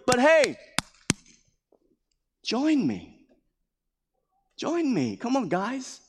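a high, coaxing plea to join him; [name] begging his friends to join him, high and coaxing; [name] pleading. Acted. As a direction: urge them gently but insistently.

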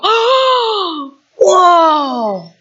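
high breathless oh, then a falling whoa; amazed whoa. Pretend. Preacher acting the moment the focus snaps in, awed cry. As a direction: struck with wonder, almost crying. Sudden clear view through binoculars.

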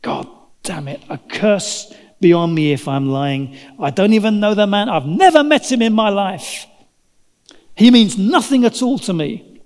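escalating furious denial as a cornered man; losing it. Acted. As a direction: start under your breath, then erupt in angry protest. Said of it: "Peter absolutely loses it".

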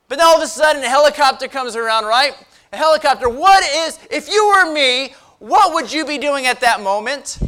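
loud, high sermon storytelling; animated preaching. Real, not acted. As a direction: drive the tale on with urgency.

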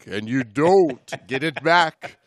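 slow, hammed-up spooky warning with a laugh; mock-ominous. Host warning his co-host about morays, hammed-up spooky. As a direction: tell it like a campfire scare.

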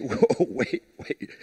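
low, quiet, startled whoa, wait; startled listener. Retold or imitated. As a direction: pull back like a stunned listener. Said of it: "This is like".

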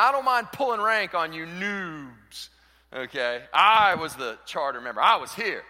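pulling rank. Retold or imitated. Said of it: "they're always pulling rank".

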